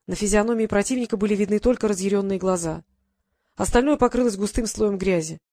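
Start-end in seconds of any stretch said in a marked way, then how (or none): none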